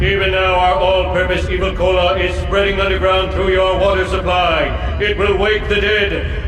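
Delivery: loud, gloating alien threat over a broadcast; booming evil broadcast. Acted. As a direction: boom it out like a villain taking over the airwaves.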